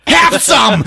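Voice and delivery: high, loud gleeful yell; mock shout. Sam blasting the cop with fire, yelling. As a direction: shout it as an attack.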